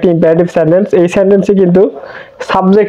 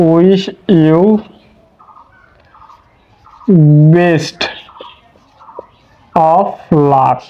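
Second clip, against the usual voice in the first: dictating slowly; much slower than usual. Shown as a demonstration. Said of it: "উদাহরণ দিলে খুব ভালোভাবে বুঝতে পারবেন".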